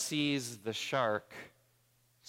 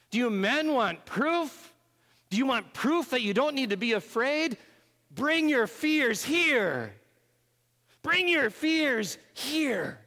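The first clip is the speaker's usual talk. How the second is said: voice of Jesus; 8 dB louder than usual. Acted. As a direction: call the frightened disciples to come close. Jesus speaking in sermon retelling.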